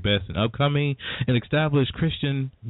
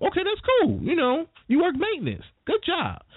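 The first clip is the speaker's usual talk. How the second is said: high, mock-approving voice, much higher; sarcastic praise. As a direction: pat him on the head with fake approval. Mocking a janitor's job praise.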